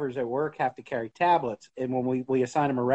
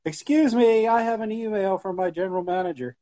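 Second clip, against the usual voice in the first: high sing-song ringtone voice; ringtone impression; 6 semitones higher than usual. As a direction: sing the alert then announce. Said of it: "It was like".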